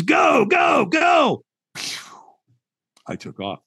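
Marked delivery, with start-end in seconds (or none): 0.0-1.4 high shouted go, go, go; shouted command. Retold or imitated. Trainer yelling at the walker to cross, high shouts. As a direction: launch him across the fire with a yell. Trainer sends him across coals.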